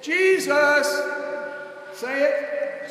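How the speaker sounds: high, loud call for the crowd's answer; loud preacher's cry; call to the congregation. Real, not acted. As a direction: rouse the congregation to shout back. Preacher calling congregation response.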